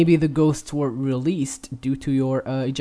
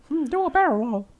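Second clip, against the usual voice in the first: game quote; faster than usual. Retold or imitated. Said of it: "I had to".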